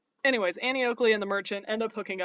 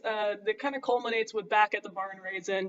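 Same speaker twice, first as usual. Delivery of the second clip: usual speaking voice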